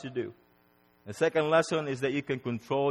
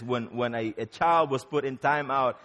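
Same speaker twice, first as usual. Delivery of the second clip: ordinary delivery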